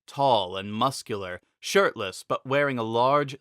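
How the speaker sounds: ordinary delivery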